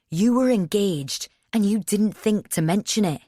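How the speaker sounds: loud, high accusing shout. A narrator's reading. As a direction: hurl the accusation at her. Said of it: "He's still shouting".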